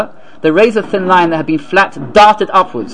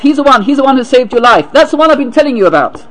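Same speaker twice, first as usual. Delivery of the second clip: raised, excited retold shout; 6 semitones higher than usual. Man pointing out the doctor to his wife, shouting. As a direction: exclaim it eagerly, pitched high. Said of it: "the man shouted, said to his wife".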